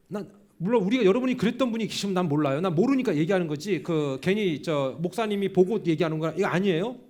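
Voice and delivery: loud, driving preacher voice; forceful preaching. Acted. (힘차게 외치는 설교)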